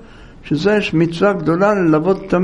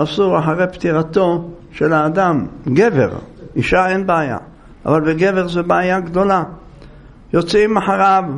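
normal talking voice

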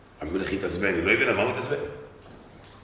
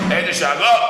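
higher, louder burst on the word; exclaimed loudly; 6 semitones higher, 6 dB louder than usual. A narrator's reading. Preacher exclaims to the crowd how the king roared, loud. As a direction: throw it out like a roar. Describing the king's roars.

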